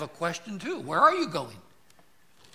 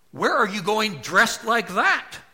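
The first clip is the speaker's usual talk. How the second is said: higher, louder mock mom scolding; disapproving mother; 7 semitones higher, 10 dB louder than usual. Preacher imitating a mother scolding her child's clothes, louder. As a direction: scold like a mom eyeing the outfit. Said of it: "I heard a mother ... ask that question".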